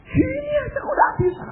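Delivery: very high, loud incredulous squeal; mock exasperation. Mocking his old test mistakes, high exasperated mock shout. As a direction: scoff at yourself in a hammed-up high shout.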